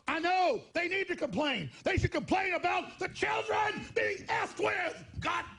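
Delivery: high, slow furious shouting at the TV stations; angry yell. Retold or imitated. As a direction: bellow it like you're fed up with them. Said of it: "I go".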